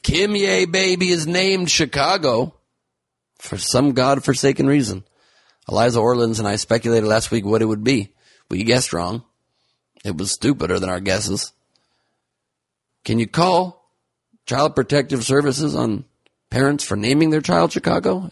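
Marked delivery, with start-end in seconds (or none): 0.0-5.0 high, slow disbelief; incredulous announcement. Acted. 13.0-18.3 high, indignant question; mock outrage.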